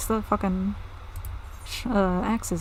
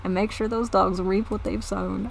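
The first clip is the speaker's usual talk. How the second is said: put-on southern accent. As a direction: grumble about the raiders in a southern accent. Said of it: "they have fucking southern accents now".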